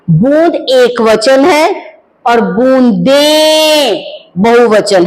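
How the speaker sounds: बूंद and बूंदे drawn out slowly, each syllable clear; slow careful pronunciation. Shown as a demonstration. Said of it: "जैसे मैं हर शब्द का उच्चारण कर रही हूं". (धीमा, साफ़ उच्चारण)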